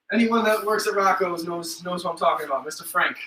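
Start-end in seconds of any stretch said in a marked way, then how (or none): none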